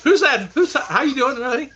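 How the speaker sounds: hint of the wife's suspicious question; wife's jealous voice. Retelling the wife's jealous call to viewers, mock suspicious. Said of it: "She's still laughing about the honey".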